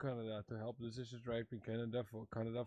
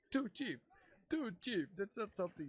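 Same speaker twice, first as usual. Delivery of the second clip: laughing; 8 semitones higher than usual. Real, not acted. Laughing at the chief scene.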